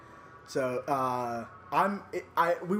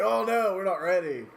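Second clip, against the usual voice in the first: mock fear; 5 semitones higher, 4 dB louder than usual. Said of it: "I'm like".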